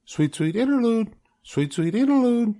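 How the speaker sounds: very high, sing-song bird call; a bright little sparrow trilling its song; songbird voice. Acted. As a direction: chirp it like a small sparrow.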